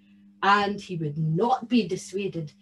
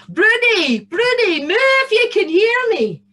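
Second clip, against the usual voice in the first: high, loud swooping call; shouting for Bruni; 10 semitones higher, 9 dB louder than usual. A narrator's reading. Tam searches for his stolen cow, shouting. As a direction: holler for a lost cow. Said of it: "some good shouting going on".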